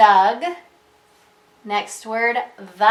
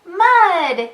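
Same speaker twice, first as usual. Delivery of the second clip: high, loud exclamation; big storybook shout, word by word; excited yell; 8 semitones higher, 7 dB louder than usual. A narrator's reading. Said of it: "yells Max".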